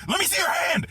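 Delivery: high, loud yelling of panicked friends; imitated frantic shouting. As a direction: shout urgently to get through to someone. Friends yelling at him, acted out.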